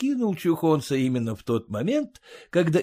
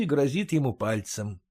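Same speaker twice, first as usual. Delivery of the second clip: ordinary delivery, nothing marked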